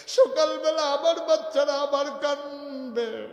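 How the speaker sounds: high, loud, anguished cry; acted desperate lament; pleading mother's wail. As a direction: wail it out for the hungry children. Mother pleads for her children.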